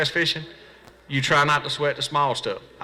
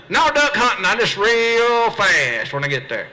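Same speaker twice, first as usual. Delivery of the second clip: sarcastic reply; 7 semitones higher, slower than usual. Retold or imitated.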